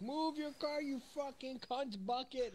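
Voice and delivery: high mock road-rage shout out the window; screaming at a driver in traffic; road rage yell. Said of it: "I would roll down my window and be like".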